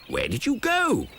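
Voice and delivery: the professor asking. A narrator's reading. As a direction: ask like a kindly old professor. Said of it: "he asks".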